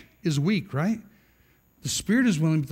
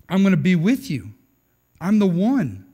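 louder, emphatic vow of loyalty; confident boast; 6 dB louder than usual. Acted. Peter pledging loyalty to Jesus, confident boast. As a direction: declare it firmly, punching the words.

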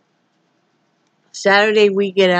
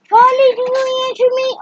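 much higher sing-song voice for the dog. Real, not acted. Owner cooing at her dog Charlie in high baby talk. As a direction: coo at Charlie in a squeaky pet voice.

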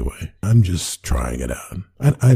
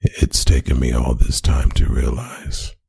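ASMR whisper. Acted. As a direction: whisper sweet nothings close to the mic. ASMR recording before interruption.

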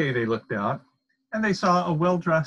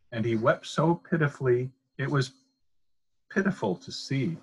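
ordinary delivery, nothing marked

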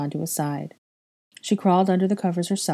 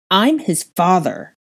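slow, forceful outburst of anger; raging; 4 dB louder, slower than usual. A narrator's reading. Father insisting on his right to his son, raging. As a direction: punch each word in slow rage. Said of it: "Draco raged".

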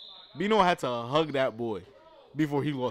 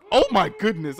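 surprised exclamation; 6 semitones higher, 10 dB louder than usual. Real, not acted. Reacts to wild play.

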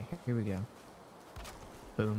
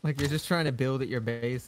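usual speaking voice

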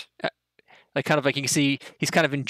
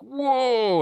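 amazed gasp; 12 semitones higher than usual. Acted. Humanoids react to goblin kill.

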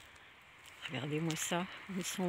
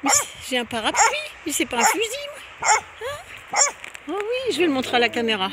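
high, loud pet-talk voice; high-pitched calling out to a guard dog; 13 semitones higher, 10 dB louder than usual. Real, not acted. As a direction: squeak up at the dog to win it over. Reassures the dog about umbrella.

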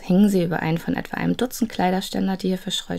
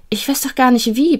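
a protest, pitched up and louder; 6 semitones higher, 6 dB louder than usual. A narrator's reading. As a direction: push back against him, higher and louder. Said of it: "protestierte Anders".

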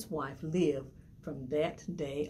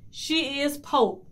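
shouted; 7 semitones higher, 5 dB louder, slower than usual. A narrator's reading. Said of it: "the flounder shouted".

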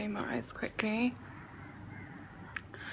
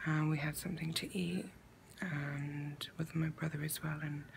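low, soft morning voice; groggy; 4 semitones lower, 8 dB quieter than usual. Real, not acted. Telling the camera about last night after waking, groggy. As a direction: talk quietly, as if barely awake. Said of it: "I'm sounding all groggy".

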